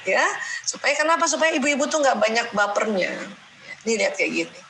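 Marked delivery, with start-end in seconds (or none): none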